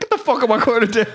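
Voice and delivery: high laughing bark; drill instructor. Retold or imitated. Drill instructor ordering him up, laughing bark. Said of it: "He's like".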